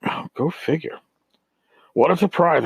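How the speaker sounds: ordinary talk, nothing marked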